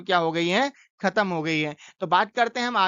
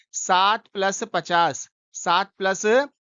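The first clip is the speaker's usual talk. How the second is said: slow, each part apart; teacher spelling out seven plus fifty; slow spelled-out sum; much slower than usual. Shown as a demonstration. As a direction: lay out the sum word by word, slowly. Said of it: "पहले आप लिखेंगे दो टुकड़ों में इसको".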